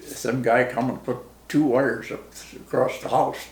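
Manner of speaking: ordinary talk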